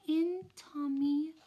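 put-on high, slow little toddler voice. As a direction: say it like a pleased little kid.

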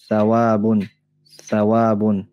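"Thawabun" mit gelispeltem Th am Anfang; "Thawabun", Zunge zwischen den Zähnen; arabisch Thawabun. Shown as a demonstration. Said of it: "Thawab in der arabischen Sprache". ("Thawabun" mit lispelndem Tha-Laut)

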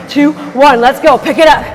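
ordinary talk

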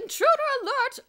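very high, very loud PA call, rising end; repeated loudspeaker alarm. A narrator's reading. Miss Scrimmage repeats the alarm over the loudspeaker. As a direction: blare it again over the speakers. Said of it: "voice came over the public address system".

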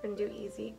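ordinary delivery

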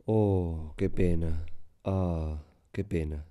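low, soft, sinking exclamation of pity; demonstrated pity. Shown as a demonstration. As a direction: let it sag down in a show of regret. (lástima de ejemplo, en voz baja y grave)

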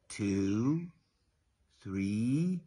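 plain reading voice

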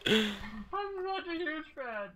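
very high, drawn-out squeal; gushing fan squeal. Acted. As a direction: squeal it slowly like a starstruck fan.

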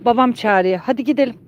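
ordinary talk, nothing marked

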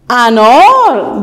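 slow, stretched opening vowel; slow drawn-out. Shown as a demonstration. Teacher drawing out the vowel for pupils. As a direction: hold the first sound long. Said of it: "آوازش میکشین".

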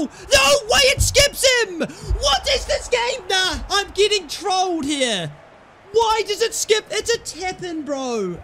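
high, loud yelps of outrage with a laugh; outraged yelling. Real, not acted. Gamer yelling at the game as the ball skips, high outraged shouts. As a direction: shout at the screen like you've been robbed.

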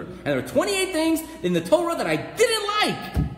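high, loud, rushing whine; hammed-up fussy objector listing grievances; mock complainer. Preacher mimicking a reader with twenty-eight objections, mock complaining.

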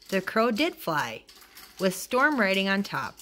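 ordinary delivery, nothing marked

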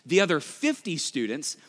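usual speaking voice

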